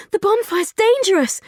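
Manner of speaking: high, loud breathless warning; gasped warning. Acted. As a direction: gasp it out in alarm. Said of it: "Frankie gasped".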